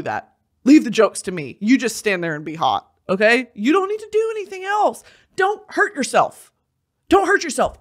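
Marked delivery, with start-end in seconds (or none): 3.5-7.8 high, pleading little lecture; mock coaxing. Telling men not to be funny, high coaxing. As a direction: coax him in a raised voice.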